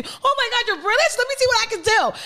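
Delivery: high, excited host voice, way up; mock gushing American host. British guest at a restaurant, gushing host voice. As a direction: gush like a starstruck American waiter.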